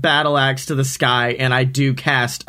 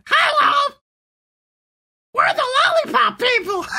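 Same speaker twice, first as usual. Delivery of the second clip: very high cartoon munchkin voice; squeaky little people. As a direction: squeak it like a tiny candy person. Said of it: "you just made a bunch of".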